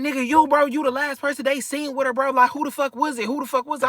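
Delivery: high, heated accusing rant; going off. Retold or imitated. Friend going off at the storyteller on the phone, demanding who it was. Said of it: "He going off".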